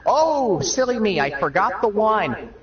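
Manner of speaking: high, mock-innocent "oh silly me"; fake-innocent Jesus. Jesus playing innocent at the family dinner, high and coy. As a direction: play it coy, like a cheap magic trick. Jesus voiced in comedy bit.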